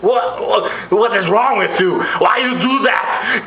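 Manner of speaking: high, halting, overwrought plea; hammed-up breakless anguish with long pauses; mock Twilight acting. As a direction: imitate an overwrought actress stuck on her words. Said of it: "acid reflex orgasm face".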